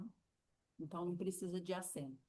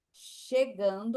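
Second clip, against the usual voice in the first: slow drawn-out; 8 dB louder, much slower than usual. Shown as a demonstration. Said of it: "Você pode falar que o tempo".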